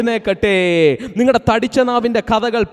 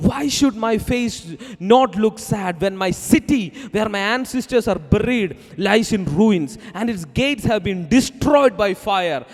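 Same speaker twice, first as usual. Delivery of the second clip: slow scripture reading; 2 semitones lower, much slower than usual. Acted. Scripture read over music.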